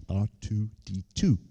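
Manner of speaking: "R two D two" said in separated pieces; careful repetition. Real, not acted. Name said over, carefully separated.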